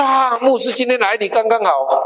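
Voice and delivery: thrilled the pastor showed up; glad surprise. Retold or imitated. Brother greeting the pastor at his door, delighted. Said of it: "他特别高兴的， 特别吃惊".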